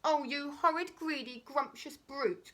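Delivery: high, loud outrage; indignant cry. Acted. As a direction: scold the brute in shock. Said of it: "cried Humpy Rumpy".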